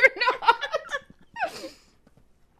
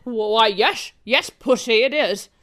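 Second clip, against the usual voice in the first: low-pitched secret agent; spy parody in a low register; suave spy; 13 semitones lower than usual. Acted. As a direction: play the cool spy, pitched well down.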